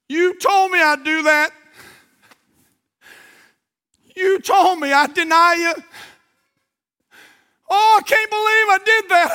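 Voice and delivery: acted high, loud wail of remorse; impassioned, high-pitched lament; anguished cry. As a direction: wail it as a man breaking with regret. Acts out Peter weeping bitterly.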